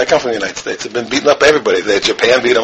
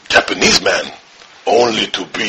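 low, deliberate caricatured voice; Japanese man; 6 semitones lower than usual. Retold or imitated. Japanese man answering the psychologist, low caricature. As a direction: deliver the punchline deep and measured. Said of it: "the Japanese guy says".

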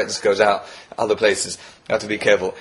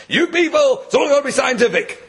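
high-pitched mock exasperation; exasperated believer; 12 semitones higher than usual. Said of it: "He says, "You don't believe".

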